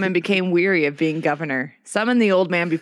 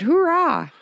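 soldiers cheering; 10 semitones higher than usual. Acted.

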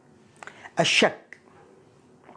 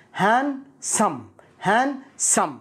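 "Handsome" split as han, sum, higher pitch; syllable by syllable; 4 semitones higher, much slower than usual. Shown as a demonstration. Teacher breaking down "Handsome" for learners, slow and split. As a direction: break "Handsome" into two separated parts, no d.

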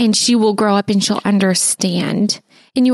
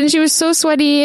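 usual speaking voice

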